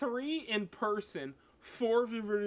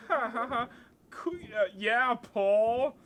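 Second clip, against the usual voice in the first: fake awkward laugh; 4 semitones higher, 6 dB louder, slower than usual. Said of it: "we're all just like".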